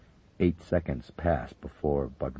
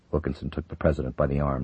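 ordinary delivery, nothing marked

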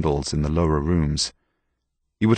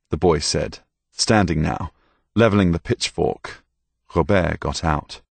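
ordinary delivery

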